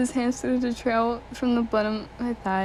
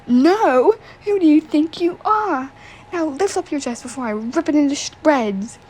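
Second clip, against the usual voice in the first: shouted, higher and much louder; angry shout; 5 semitones higher, 6 dB louder than usual. Acted. Bully yelling at the girl to obey, angry. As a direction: bark the order like a furious bully. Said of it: "I could tell he was angry".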